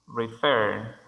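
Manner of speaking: "Refer" with the weight on FER; stress on second syllable. Shown as a demonstration. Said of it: "tiene énfasis de sonido en la segunda".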